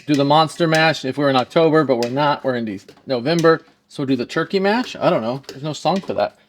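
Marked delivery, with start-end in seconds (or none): none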